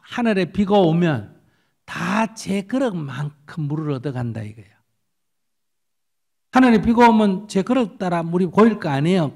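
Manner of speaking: ordinary delivery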